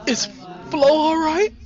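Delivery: high, loud nervous question. Acted. Nisi nervously asks Mary about Flo. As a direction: blurt it out anxiously.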